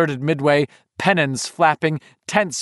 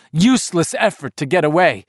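usual speaking voice

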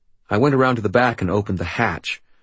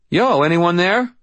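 complaining; 10 semitones higher, 5 dB louder than usual. Acted. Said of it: "I complained".

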